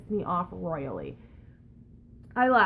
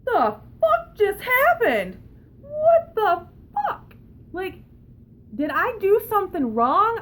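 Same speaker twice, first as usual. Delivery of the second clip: much higher, slow, whiny mock wail; mock disbelief; 10 semitones higher, 6 dB louder, slower than usual. Retelling her shock at the clerk, in a high mock wail. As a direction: whine it in stunned disbelief, near tears. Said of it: "And I'm just like".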